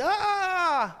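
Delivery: loud high-pitched scream; high, loud mock scream of pain; brother's scream. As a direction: imitate a kid's pained scream. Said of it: "My brother was screaming".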